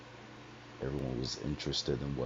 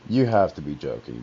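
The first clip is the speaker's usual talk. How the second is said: louder, insistent denial; loud disbelief; 10 dB louder than usual. Retold or imitated. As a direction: refuse to believe it. Disbelief at friend's death news.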